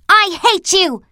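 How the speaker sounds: choked, high furious cry; strangled voice. A narrator's reading. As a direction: cry it out through a tight throat. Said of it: "she cried in a choked voice".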